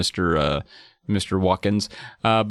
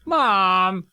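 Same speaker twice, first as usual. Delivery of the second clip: put-on high, drawn-out kid whine; hammed-up put-upon child; protesting kid; 8 semitones higher than usual. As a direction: whine back at mom. Acting kid protesting to mom.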